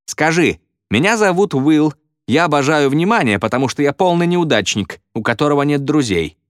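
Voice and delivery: high, mocking dictation; feed him the humiliating words, pitched high; bullying taunt. A narrator's reading.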